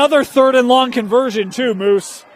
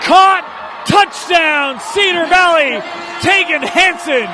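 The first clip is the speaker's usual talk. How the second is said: touchdown call; 5 semitones higher, slower than usual. Real, not acted. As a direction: shout the score like the crowd's on its feet.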